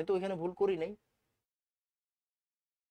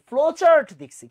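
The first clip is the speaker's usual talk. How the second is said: higher and louder, punching chart; emphatic louder repeat; 7 semitones higher, 12 dB louder than usual. Shown as a demonstration. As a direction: drive the point home to the class. Teacher repeats key phrase louder.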